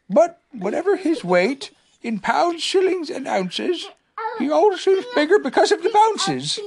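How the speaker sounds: high, musing bear voice, half to himself; murmuring Pooh. Acted. Pooh murmuring his poem to himself, high and dreamy. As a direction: Pooh trying out his rhyme. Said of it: "he murmured to himself".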